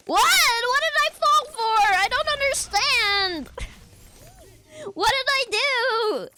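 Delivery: high, loud strained shriek; mock outrage. Streamer squealing after falling for the joke. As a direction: shriek it in hammed-up disbelief. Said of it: "trying to do a bit".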